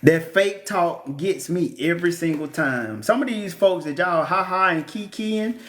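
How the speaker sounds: ordinary talk, nothing marked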